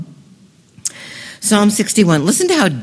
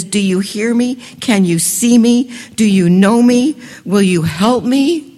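growing anguished outcry; building desperate plea; 4 semitones higher, 5 dB louder than usual. Acted. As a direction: cry out to God, rising in urgency. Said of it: "he asks this".

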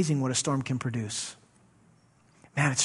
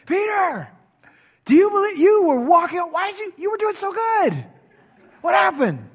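much higher and louder, thrilled and amazed; excited Jesus; 8 dB louder than usual. Shown as a demonstration. Jesus greeting Peter after his water walk, excited, high and loud. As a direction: cheer Peter on like a proud coach, pitch way up. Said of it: "I think Jesus was excited for Peter".

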